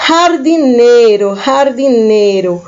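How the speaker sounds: "Jardineiro" with the J as a breathy h sound: har-di-NEI-ro; Spanish J sound. Shown as a demonstration. Teacher to students, Spanish "jardineiro" with an h. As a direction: open "jardineiro" on a rough h, Spanish style. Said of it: "a letra J em espanhol tem o som de R".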